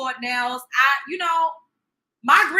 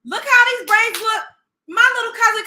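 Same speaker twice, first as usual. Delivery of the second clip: higher, louder mocking cry; scornful shout; 8 semitones higher, 7 dB louder than usual. Real, not acted. Mocking the braids to the chat, higher scornful shout. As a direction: shout it in disbelief.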